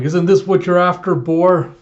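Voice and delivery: loud, high taunting call; retold loud challenge. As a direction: draw the boar's attention. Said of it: "someone calling".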